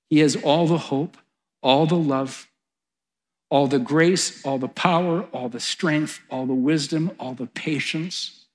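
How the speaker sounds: usual speaking voice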